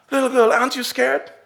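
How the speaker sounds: put-on a nervous passenger leaning over to the girl; worried businessman. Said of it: "And he says to her".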